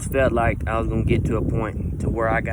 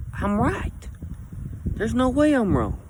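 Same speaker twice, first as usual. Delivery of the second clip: stubborn insistence; 9 semitones higher than usual. Retold or imitated. Imitating stubborn self.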